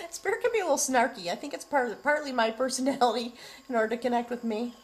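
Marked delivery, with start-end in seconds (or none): 0.0-1.3 high, loud and quick through a laugh. Real, not acted. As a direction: giggle it out, amused by Spirit. Laughing over snarky Spirit's message.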